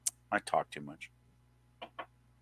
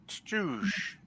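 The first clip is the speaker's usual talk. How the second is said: cymbal sound; 9 semitones higher, 10 dB quieter than usual. Pretend. Imitating a cymbal after rimshot.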